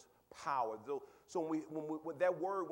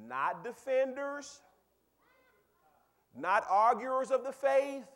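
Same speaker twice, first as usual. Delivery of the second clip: high, loud and slow preaching; preaching emphasis; 8 semitones higher, 9 dB louder, slower than usual. Acted. Preacher driving the point home to the congregation, slow loud emphasis. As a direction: hammer each phrase home slowly.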